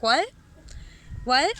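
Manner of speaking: baffled "what?" with a sharp questioning rise; struggling to understand. Retold or imitated. Re-enacting not understanding a Bavarian at a bar, baffled. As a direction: repeat it with pauses, lost and puzzled. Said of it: "I struggled. I was like".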